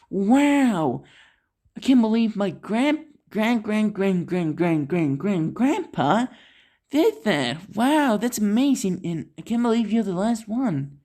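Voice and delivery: high-pitched, excited wonder; acted wide-eyed amazement; amazed. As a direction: gush in disbelief, pitch riding high. Girl amazed at learning about grandpa.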